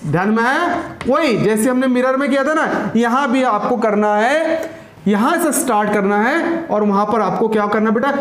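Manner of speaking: usual speaking voice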